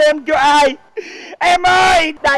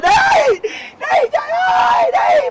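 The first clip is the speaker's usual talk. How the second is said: yelling at horse; 12 semitones higher, slower than usual. Acted. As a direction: shout very high at the horse. (hét lên the thé giục ngựa đi)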